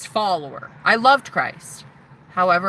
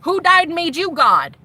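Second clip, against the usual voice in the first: defiant challenge; 7 semitones higher, 3 dB louder than usual. Retold or imitated. Challenging the church leaders.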